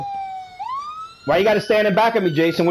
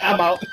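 squealing laugh while fleeing; shrieking laugh; 9 dB quieter than usual. Real, not acted. High shriek then laugh.

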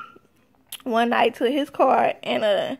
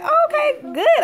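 high, bright squeal of delight; retold excitement; 4 dB louder than usual. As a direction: squeal it out, thrilled at the news. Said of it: "I was happy".

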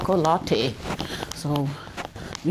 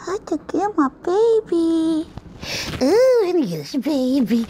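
higher, slow sing-song coo; 8 semitones higher than usual. Real, not acted. Grandma picks up the newborn, cooing high baby talk.

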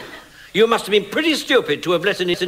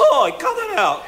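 very high comic protest; ridiculous high-pitched objection; silly high-pitched voice; 13 semitones higher than usual. Acted. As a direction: squeal indignantly in a silly voice. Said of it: "He speaks in a silly, high-pitched voice".

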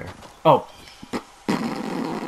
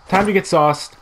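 higher, louder hype call; hyped catchphrase; 7 semitones higher, 5 dB louder than usual. Real, not acted. Gamer hyping his squad before the match, loud and excited. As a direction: pump himself up for the fight.

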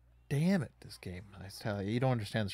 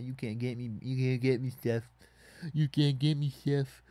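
put-on childish playground taunt at the opponent; sing-song mocking jeer; lightly voiced taunt, a bit louder; 3 semitones higher, 4 dB louder than usual. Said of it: "Gonna say".

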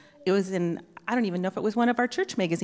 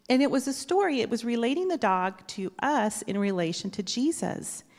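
usual speaking voice

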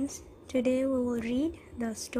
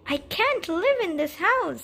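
higher, louder fed-up cry; exasperated; 7 semitones higher, 5 dB louder than usual. Acted. Man fed up with his noisy house, exasperated. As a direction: throw up your hands in despair.

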